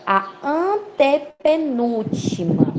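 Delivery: "antepenúltima" stretched out slowly, opening syllable held; stress on ANTEPENÚLTIMA. Shown as a demonstration. Teacher naming the stressed syllable's position, "antepenúltima" slow and emphatic. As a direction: spell out "antepenúltima" slowly, piece by piece.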